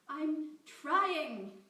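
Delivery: loud wailing cry. Acted. Captive Amy wailing desperately at her captor. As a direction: wail like a child in distress. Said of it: "It was almost a wail".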